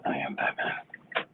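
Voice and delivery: slow hoarse whispered voice; no voice left, whisper it; raspy whisper. Real, not acted. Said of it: "I have no voice".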